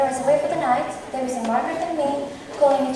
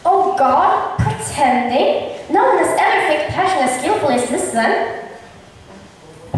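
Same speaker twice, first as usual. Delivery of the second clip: higher, louder, slow theatrical disbelief; mock outrage; 3 semitones higher, 4 dB louder, slower than usual. Feigning shock so hidden Benedict overhears, loud and hammy. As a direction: make a show of being shocked.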